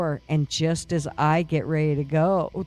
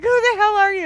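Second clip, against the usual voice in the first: much higher, loud scared demand; imitating a frightened demand; 8 dB louder, faster than usual. Demanding the actress's identity in mock fright. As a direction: shriek the question, half terrified. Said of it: "and I'm like".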